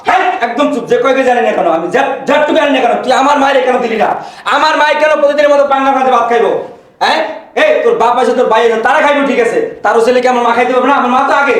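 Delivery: angry retort. Acted. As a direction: fight back for your mother. Husband fights back in quarrel. (মায়ের পক্ষে স্ত্রীর ওপর রেগে ঝগড়া)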